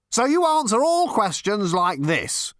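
high, slow, incredulous accusation; pompous exasperation; exasperated challenge. A narrator's reading. Zarniwoop exasperated by evasions.

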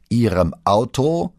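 slow, loud, rising disbelief; drawn-out, high incredulous question; incredulous echo. A narrator's reading. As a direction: throw the answer back as an astonished question. Boss echoes answer in disbelief.

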